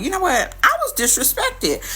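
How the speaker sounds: indignant realization. Retold or imitated. Deciding to leave him.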